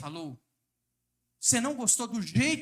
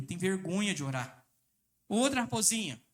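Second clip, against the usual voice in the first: normal talking voice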